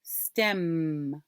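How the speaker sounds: STEM with a long hummed M; final sound held. Shown as a demonstration. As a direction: draw out the M ending STEM. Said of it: "What's the final sound?".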